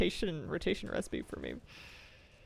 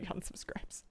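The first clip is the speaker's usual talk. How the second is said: whisper; 12 dB quieter, slower than usual. Acted. Joke about burning onions.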